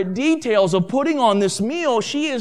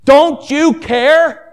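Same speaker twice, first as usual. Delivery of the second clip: loud, higher-pitched angry outburst at Jesus; angry reproach; 3 semitones higher, 5 dB louder than usual. Acted. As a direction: burst out in exasperated accusation, stressed and fed up. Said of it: "You can just hear the anger in her voice".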